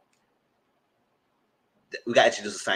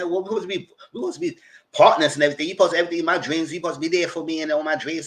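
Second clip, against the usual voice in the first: imitating her plea; 5 semitones higher, 3 dB louder, much faster than usual. Retelling her pleading.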